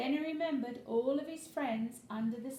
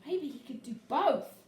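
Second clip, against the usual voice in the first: ordinary delivery, nothing marked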